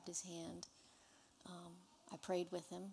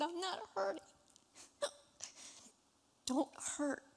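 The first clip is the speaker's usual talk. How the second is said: choked-up tears; 11 semitones higher than usual. Real, not acted. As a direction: say it as tears break through. Retelling father's words, overcome.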